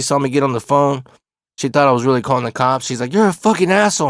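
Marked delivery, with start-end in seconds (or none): 3.1-4.1 high-pitched upset outburst, imitated; retold cry of outrage, pitched well up; tearful insult. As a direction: imitate a distressed woman lashing out. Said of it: "And she's crying".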